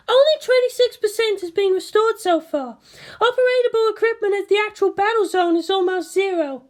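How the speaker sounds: high woman's voice, briefing; voiced commanding officer. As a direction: report damage like an operations chief. Misato briefing.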